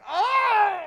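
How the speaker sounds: very high, loud mock-agonized cry; overwhelmed fan wail. Overcome viewer reaction to scene.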